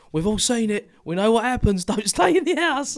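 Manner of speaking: coworker's warning. Retold or imitated. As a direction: warn him half-laughing. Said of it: "He's like".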